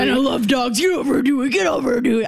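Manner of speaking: goofy dog-loving dude calling a dog; lightly voiced, calling out to the dog; Dan impression. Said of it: "this is my impression of Dan".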